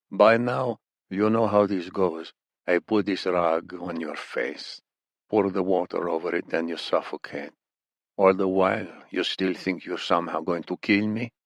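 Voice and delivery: put-on menacing interrogator with a thick accent; accented villain explaining the torture to his prisoner; thick Iranian accent, matter-of-fact threat; Iranian captor.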